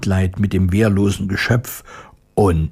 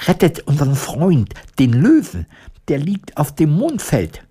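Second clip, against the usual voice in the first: higher, pleading scarecrow voice; urgent scarecrow; 7 semitones higher than usual. A narrator's reading. Scarecrow interrupting to save the lion, urgent. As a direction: cut in with a raised plea.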